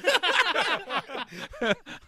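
loud high burst of laughter; big laugh. Real, not acted. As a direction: let out a loud howl of laughter. Laughing at own joke.

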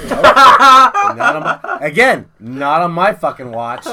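loud, laughing boast at first; laughing denial. Retold or imitated. Caller answers doctor's question, loud and laughing. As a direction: burst out with it, then settle.

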